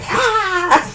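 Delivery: high laughing yelp like a rat jumps out; mock startled yelp. Retelling rats leaping from trash bags, mock startled yelp. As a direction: squeal in mock fright. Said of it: "they hop out like".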